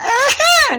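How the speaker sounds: high shouted burst of laughter; a whoop of happy laughter; delighted laugh. Real, not acted. Caller laughs at being allowed.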